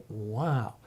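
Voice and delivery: usual speaking voice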